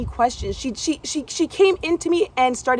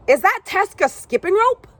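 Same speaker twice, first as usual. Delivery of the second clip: high, louder, sugary questioning; fake nice; 10 semitones higher, 6 dB louder than usual. Gym owner questioning the young girl, fake nice voice. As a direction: put on a falsely sweet adult tone. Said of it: "trying to say it in a, a nice way".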